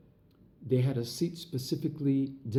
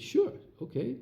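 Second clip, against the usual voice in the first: a much higher, delighted quick yes; eager yes; 11 semitones higher than usual. Retold or imitated. Dancer accepts designer's hotel invitation, high eager yes. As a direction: jump at the offer.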